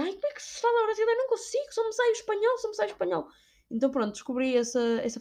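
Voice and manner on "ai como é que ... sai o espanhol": high, fretful put-on voice, much higher; flustered self-quote. Retelling a call to Oscar, high fretful whine. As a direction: squeak out a helpless complaint. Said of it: "E dizia".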